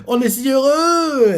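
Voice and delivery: high, slow, teary voice of joy; tearful happiness. Retold or imitated. Ginny eating, tears in her eyes, says it tearfully. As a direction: sob out the happy words. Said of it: "les larmes aux yeux".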